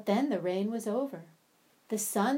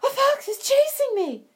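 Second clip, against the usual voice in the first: high, louder panicked cry; frightened rabbit cry; 9 dB louder than usual. Acted. Rabbit chased by a fox cries out in panic, high and loud. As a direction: yelp for help while fleeing. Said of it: "he cried".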